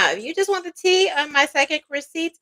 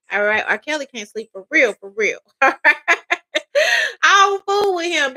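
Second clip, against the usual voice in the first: ordinary delivery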